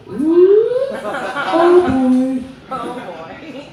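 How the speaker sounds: put-on a high "oh boy" fading to a hush. Caught by his wife.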